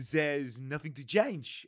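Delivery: slow, hammed-up French accent quoting the team boss; badly faked French accent, stretched out; full French accent. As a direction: do the manager in over-the-top French. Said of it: "just do it full French then".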